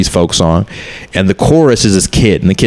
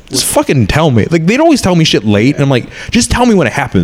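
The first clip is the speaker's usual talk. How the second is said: higher-pitched exasperated plea to family; retold frustration; 7 semitones higher than usual. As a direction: demand to be told, voice pitched up. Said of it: "I was fucking pissed at first".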